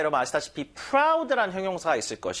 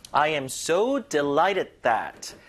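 "so" stretched with emphasis before "delighted"; stress on so; much slower than usual. Shown as a demonstration. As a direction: lean hard on "so". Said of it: "so의 강세를 주시는데". ("so"에 강세)

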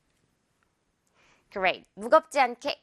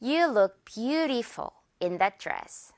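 slow demo; much slower than usual. Shown as a demonstration. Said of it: "한 번 더".

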